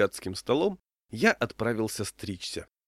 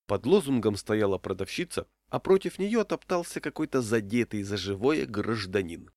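usual speaking voice

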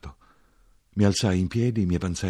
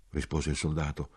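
ordinary delivery

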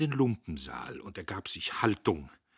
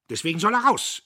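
high, loud insistence; explosive indignant reply; angry retort; 13 semitones higher, 13 dB louder, faster than usual. A narrator's reading. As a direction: shout back stubbornly. Boss retorts to bookkeeper.

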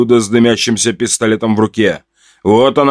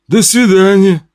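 higher, sighing goodbye; sad sigh; 7 semitones higher than usual. Acted. Rejected suitor leaving, sad sighed goodbye. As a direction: sigh it out sadly as you leave. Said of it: "грустно выдохнул Улыбака".

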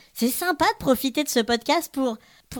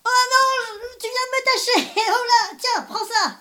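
excited; 10 semitones higher than usual. Acted. Cake fight play.

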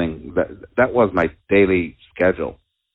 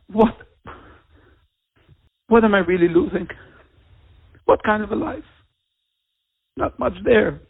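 high, cracking voice close to tears; fighting back real tears, the voice breaking high; 13 semitones higher than usual. Real, not acted. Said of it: "It's a little hard for me to talk".

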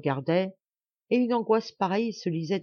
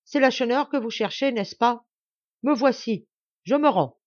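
loud, higher, proud surrender; strong voice; 6 semitones higher, 5 dB louder than usual. Acted. Lacheneur surrendering to the soldiers, loud and proud. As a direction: announce yourself boldly to the soldiers. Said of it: "d'une voix forte".

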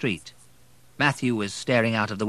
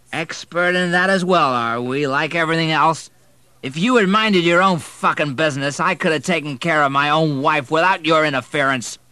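put-on loud, high sneering drunk yell; angry drunk shouting; 8 semitones higher, 9 dB louder than usual. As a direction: spit it back, drunk and furious. Drunk stepfather shouts back.

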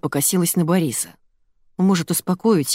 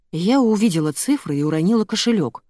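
ordinary delivery

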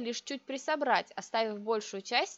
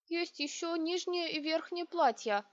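higher-pitched, timid servant's voice; timid babbling; 6 semitones higher than usual. A narrator's reading. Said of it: "пролепетала Айдор". (робкий лепет повыше)

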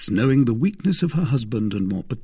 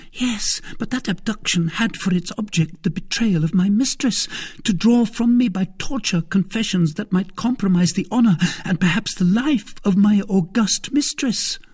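high, urgent, emphatic woman's voice; Madame Bonacieux, impassioned wife; 12 semitones higher, 4 dB louder than usual. Acted. Wife defending her mistress against betrayal, impassioned. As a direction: protest with loyal passion.

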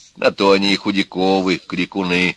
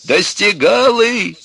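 high, drawn-out yell; angry shout; 13 semitones higher, 4 dB louder, much slower than usual. A narrator's reading. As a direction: shout back at your son. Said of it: "закричал на него отец". (сердитый протяжный крик)

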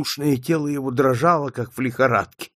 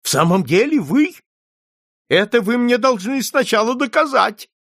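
put-on high, loud challenge from an old client; agitated old man; 11 semitones higher, 6 dB louder than usual. A narrator's reading. Old client challenges the detective, high and agitated. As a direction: demand it in a raised, high voice.

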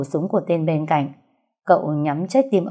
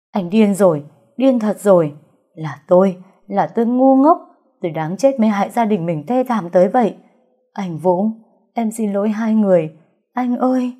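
loud, raised, grief-stricken outburst; anguished crying; 3 semitones higher, 4 dB louder than usual. A narrator's reading. Bereaved daughter blames herself and begs forgiveness, crying out. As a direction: lament loudly, voice rising in despair. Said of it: "Cô khóc tới đau thắt ruột".

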